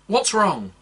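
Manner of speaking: high, loud urgent question; elf concern. Acted. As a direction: ask with quick concern. Elf asks about injury.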